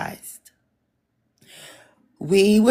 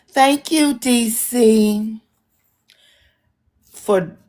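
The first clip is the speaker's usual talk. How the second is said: higher, sultry singer-style thanks; 6 semitones higher, much slower than usual. Shown as a demonstration. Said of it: "in my [name] voice".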